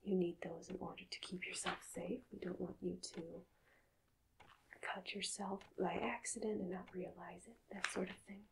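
ordinary talk

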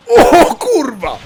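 loud, high, drawn-out outburst; blurted high yell; startled swearing shout. Real, not acted. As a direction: shout it out in sudden shock. Sudden shock at game event.

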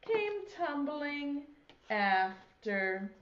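slow, lilting rhyme recitation; reciting a nursery rhyme. Shown as a demonstration. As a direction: recite it slowly for little kids. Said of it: "It goes like this".

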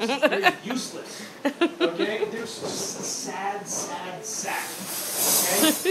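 ordinary talk, nothing marked

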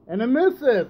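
high mock-cheery announcement of the miss; exasperated fake enthusiasm; sarcastic groan. Real, not acted. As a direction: sell the miss like it's great news. Sarcastic commentary on a missed attack.

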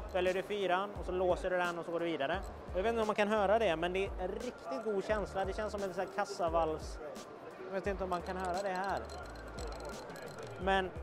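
ordinary talk, nothing marked

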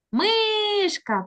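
"Мишка" called out high, first syllable stretched; sing-out stress on МИ in "Мишка"; calling the word. Shown as a demonstration. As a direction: call "Мишка" with a long, high МИ. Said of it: "Ану, погукай мишку".

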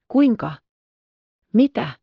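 shocked cry. A narrator's reading. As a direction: gasp it out in alarm. Rottenmeier shocked by kittens. (säikähtynyt huudahdus)

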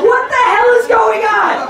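high, slow, stunned outcry; shocked bystander. Pretend. Comedian voicing stunned bank customers. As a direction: gasp it like a panicked hostage. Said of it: "Everybody's gonna be like".

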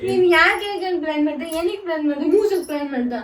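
loud, cross demand for an explanation; angry scolding. Real, not acted. Wife confronts husband over his planned trip, angry scolding. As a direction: really scold him, loudly.

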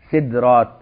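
سدرات as "sidrāt", d joined straight to r; vowelless d. Shown as a demonstration. Teacher lists valid plurals, سدرات said "sidrāt". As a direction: say سدرات with i after s and sukun on d. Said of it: "كل ده ينفع بسكون الدال".

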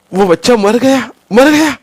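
high, loud panicked shout; crowd shouting. Acted. Onlookers shouting that the baby died, loud panicked yell. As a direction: yell that the child is dead. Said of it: "असं बघ्यातली माणसं ओरडली".

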